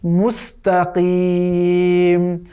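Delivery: মুস্তাকিম slow, with a clear সিন and a deep ক; মুস্তাকিম said slowly; correct pronunciation. Shown as a demonstration. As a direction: draw out মুস্তাকিম carefully. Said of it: "পড়তে হবে".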